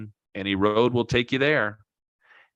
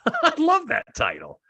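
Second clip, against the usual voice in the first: a real laugh breaking into higher speech; 13 semitones higher than usual. Real, not acted. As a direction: chuckle through it, delighted. Amused by the book title.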